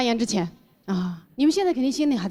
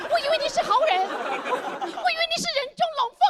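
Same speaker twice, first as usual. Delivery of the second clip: very high, hammed-up heartbroken protest; betrayed foreign friend. Foreign friend confronting the shop boss, comically betrayed. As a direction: wail the accusation in a high put-on voice. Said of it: "对着打印店的老板".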